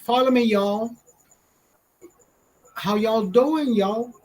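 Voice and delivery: high, drawling fake Southern voice; exaggerated Southern twang with long "y'all"; mock Southern accent. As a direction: parody a TikToker putting on a Southern drawl. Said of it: "have the fucking Southern accent".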